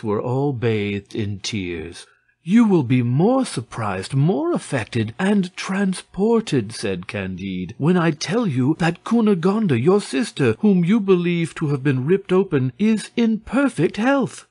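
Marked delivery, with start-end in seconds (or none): 2.4-6.7 high, building eagerness; excited revelation. A narrator's reading. Candide announces astonishing news. 7.8-14.5 high-pitched, eager good news; elated announcement, voice lifted high; excited revelation. A narrator's reading. Candide reveals sister is alive.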